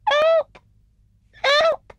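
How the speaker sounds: very high, small cry for help; tiny squeak. A narrator's reading. Hedgehog trapped inside a snowball, tiny squeak. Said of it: "squeaked a tiny muffled voice".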